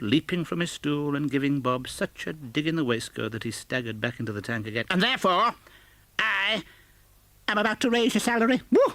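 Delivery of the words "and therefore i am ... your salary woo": very high, fast joyful announcement; gleeful reveal. Acted. Scrooge surprising Bob with a raise, gleeful. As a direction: burst out with the good news.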